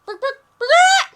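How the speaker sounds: high, loud yapping barks; dog barking. Pretend. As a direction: shout each bark like a dog. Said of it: "Uh, dog".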